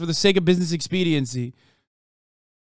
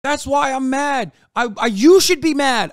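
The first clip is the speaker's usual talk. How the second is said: ordinary talk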